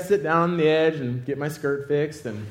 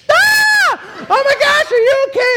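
very high, loud alarmed scream; mock shriek; 10 dB louder than usual. Startled operator on the microphone, shrieking in alarm. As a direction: imitate her screaming into the microphone.

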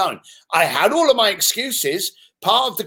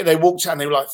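ordinary delivery

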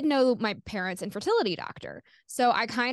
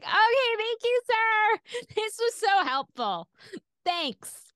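much higher, slow, sugary sarcasm; fake polite thanks; 12 semitones higher, slower than usual. Teenager hanging up on the doctor, sarcastically sweet and high. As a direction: thank him sweetly while meaning the opposite. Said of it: "And I was just like".

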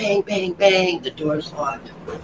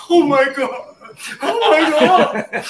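high, loud, drawn-out mock wail; hammed-up sobbing shock, pitched way up; mock tearful; 11 semitones higher, 5 dB louder, slower than usual. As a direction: imitate a distraught paramedic. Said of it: "he was crying".

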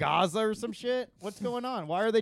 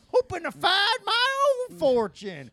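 put-on cackly wheezy old timer; 12 semitones higher, 6 dB louder than usual. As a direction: play a gold-digging old-timer cackling along. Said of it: "I'm like an old prospector".

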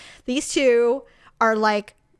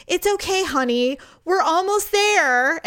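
mock sweet mother; 6 semitones higher than usual. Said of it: "She, she goes".

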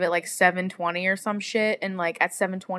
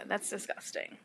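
disgusted whisper; 13 dB quieter than usual. Real, not acted. Reacting to the creepy bus guy, a disgusted whisper. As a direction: whisper it in disgust, breathy and soft. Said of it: "disgust whispered".

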